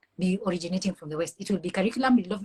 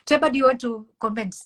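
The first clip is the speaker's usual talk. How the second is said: laughing; 4 semitones higher, 4 dB louder than usual. Real, not acted. Laughs before handing over.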